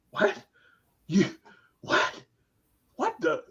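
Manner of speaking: halting, broken-off sputter of disbelief; acted speechless astonishment; dumbfounded. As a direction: grope for words with gaps between them. Retold disbelief at father's apology.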